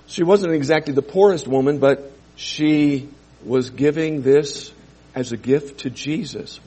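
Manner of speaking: normal talking voice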